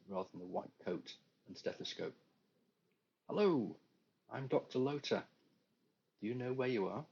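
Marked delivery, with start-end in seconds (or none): 3.3-7.0 higher, louder doctor with just a hint of an accent; brisk doctor, light touch of accent; doctor with slight accent. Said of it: "with just a hint of an accent".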